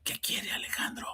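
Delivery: whispered back in a teasing echo; mock whisper. Analyst whispering back to the hushed new father, teasing. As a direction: whisper it, mirroring him. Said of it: "¿Por qué me habla así?".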